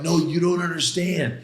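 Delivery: excited insistence. Retold or imitated. Son insisting to doubting mom, excited.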